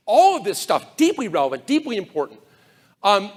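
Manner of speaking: usual speaking voice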